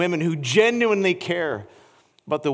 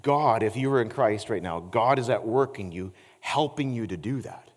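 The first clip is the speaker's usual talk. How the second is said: quiet earnest preaching; 8 semitones lower, 7 dB quieter than usual. Acted. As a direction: draw them in close and confess gently. Intimate quiet preaching section.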